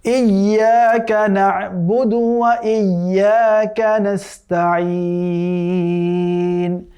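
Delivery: slow, melodic tajweed chant drawn out at the end; chanted Quranic recitation. Shown as a demonstration. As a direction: recite the verse once, slowly, with full tajweed. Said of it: "আমি আয়াতটি আগে একবার তিলাওয়াত করছি".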